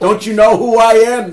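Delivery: put-on high, loud drunken shout of entitlement; drunken yell. Drunk airman yelling at base guards, loud indignant shout. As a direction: bellow it at the gate guards like a drunk big shot.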